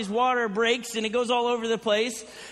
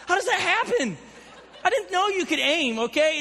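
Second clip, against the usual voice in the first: laughing disbelief; 6 semitones higher than usual. Real, not acted. Disbelief about horse poop.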